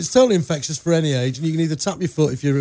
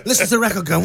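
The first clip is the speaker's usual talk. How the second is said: ordinary talk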